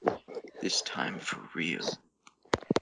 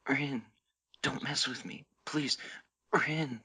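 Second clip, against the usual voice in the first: pleading; 7 semitones higher than usual. Acted. Haru pleading in fic reading.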